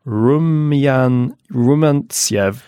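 Rumyan-, then Rumantsev, sounded out slowly; trying out names. Shown as a demonstration. Reader trying out Russian names for co-host, tentative. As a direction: feel your way through Rumantsev. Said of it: "trying these names out".

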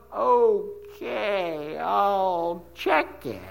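very slow, lazy sloth drawl from Zootopia; dragged-out mock-sloth reply; sloth-slow clerk. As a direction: stretch every word out like the movie sloth. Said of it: "so I saw them going".

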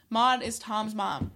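usual speaking voice

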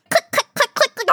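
high clicking noises; clicking sound effect. Pretend. Foley artist co-host makes clicking noises for the scene. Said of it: "that's good sound effects".

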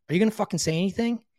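impatient outburst, fast and loud; frustrated demand. Retold or imitated. To the silent sponsor on the phone, exasperated and loud.

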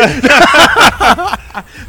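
high, loud burst of laughter; loud laugh at his own punchline; big laugh. Real, not acted. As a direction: let out a big real laugh. Punchline of tattoo story.

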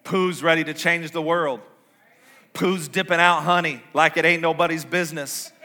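ordinary delivery

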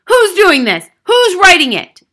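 high, loud demanding yell; alarmed, furious questioning. A narrator's reading. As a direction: shout it at the whole room. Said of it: "she yelled at nobody in particular".

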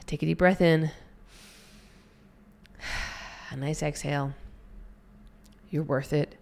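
soft, calm breathing guidance; guided breathing. Acted. Coach guiding listeners through a breath, soft and calm.

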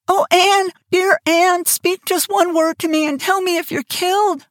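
high, pleading and frightened; pleading Diana. A narrator's reading. Diana begging her friend to speak, high and frightened. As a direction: beg her to answer, voice up high. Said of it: "shrieked Diana".

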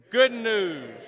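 loud, emphatic 'good news'. Shown as a demonstration. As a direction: lead the crowd in a glad cheer. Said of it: "if you wanna say it emphatically".

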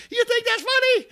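high, loud imitated outburst; shrieked mock indignation; freaking-out wife. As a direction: mimic an outraged wife yelling, voice leaping way up. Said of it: "she's freaking out".

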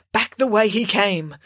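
plain reading voice

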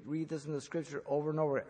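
normal talking voice

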